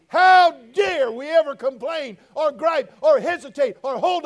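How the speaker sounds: builds from firm to a loud, slowed preacher's shout; preaching at full force. Acted. Preacher to young people, building to a thundering shout. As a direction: drive it home like a revival preacher.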